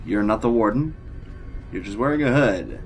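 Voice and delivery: ordinary talk, nothing marked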